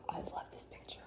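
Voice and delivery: breathless fast whisper after a gasp; gasp, then whisper it quickly in delight; hushed delighted whisper. Real, not acted. Gasps at picture.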